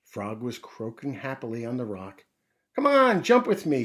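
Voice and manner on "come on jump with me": much higher, louder cheerful call. Acted. Frog calling the mouse to jump with her, loud cheerful call. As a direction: call out playfully to a friend. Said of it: "she called when she saw Field Mouse".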